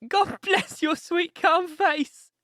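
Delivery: put-on high, slow, tearful old woman; hysterical sob. A narrator's reading. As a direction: cry it out, drawn out and overwrought. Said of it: "she cried with a hysterical sob".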